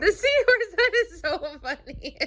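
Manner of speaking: real laughter, delighted by the story; laughing. Real, not acted. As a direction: crack up at the joke. Parent laughing at picture.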